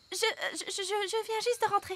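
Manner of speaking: nervous stammering excuse; flustered stammer. Acted. Madame Elf, caught out, stammers an excuse to the queen. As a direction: trip over the words, caught in a lie.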